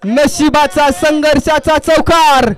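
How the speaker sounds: rising live call of the shot; excited commentary. Real, not acted. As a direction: call the boundary as it happens. (उत्साही समालोचन)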